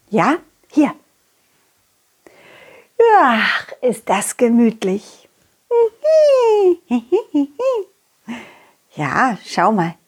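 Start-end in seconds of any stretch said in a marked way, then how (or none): 5.7-7.8 squealing happy laugh; high laugh. Acted. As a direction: giggle up high with delight. Cosy laugh watching clouds.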